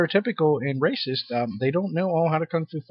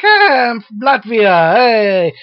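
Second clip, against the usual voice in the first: higher, louder and slow, hammed-up cheer; cheerful character voice; 8 semitones higher, 8 dB louder, much slower than usual. Voicing a cheerful anime Latvia, hammed-up and high. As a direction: play the happy Latvian character, beaming. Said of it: "he's like".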